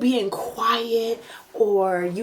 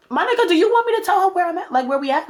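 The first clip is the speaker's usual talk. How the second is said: high, fast sarcastic challenge; mock him for hiding her; sarcastic retort; 10 semitones higher, faster than usual. Mocking retort about his girlfriend.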